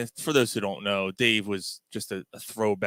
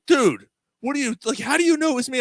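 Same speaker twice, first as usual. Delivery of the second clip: high, louder retold exasperation; mock alarmed disbelief; 6 dB louder than usual. Sister's cop boyfriend to prankster, alarmed disbelief. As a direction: imitate a rattled cop, pitched up.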